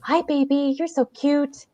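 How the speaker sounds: put-on high, cooing baby talk; baby talk to dog. A narrator's reading. Narrator greeting Sandy, high cooing baby talk. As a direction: coo at a sweet dog.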